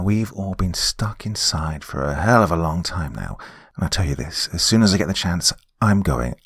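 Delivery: ordinary delivery, nothing marked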